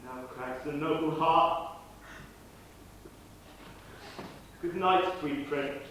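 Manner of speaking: soft, slow, grieving farewell; tender farewell. Acted. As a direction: say goodbye gently through grief. Horatio mourns Hamlet's death.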